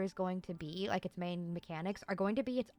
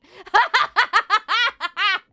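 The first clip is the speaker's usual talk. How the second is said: loud, high burst of laughter; excited cackle; 13 semitones higher, 19 dB louder than usual. Real, not acted. Player thrilled by her crit numbers, cackling loudly.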